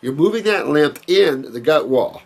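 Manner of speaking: ordinary delivery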